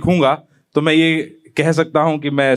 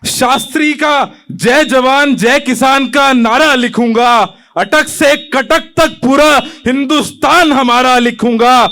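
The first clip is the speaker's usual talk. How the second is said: raised, rolling patriotic declamation; impassioned poem recitation; 7 semitones higher, 5 dB louder, slower than usual. Acted. Young poet pledging to rewrite India, impassioned. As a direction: proclaim it with drawn-out stresses.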